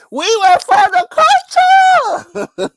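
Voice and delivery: high, drawn-out mock boast, very loud; playful shout. Boasting to a co-host about knowing the gossip, playful high shout. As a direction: whoop it out through laughter.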